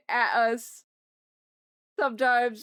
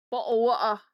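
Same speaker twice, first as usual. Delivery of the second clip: loud mock-British accent; 6 dB louder, faster than usual. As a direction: put on a playful British accent. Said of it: "Her accent was like that genuinely".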